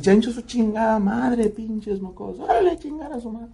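high-pitched furious cursing, slowed at first; a protective mom tearing into the bullies; mother leans out and curses at the boys who teased her son, high and angry; mother's angry cursing. Retold or imitated.

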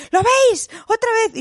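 put-on very high, loud child's cry; little girl pointing out the swear; excited daughter. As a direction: squeal like a kid catching it again. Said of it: "de repente decía".

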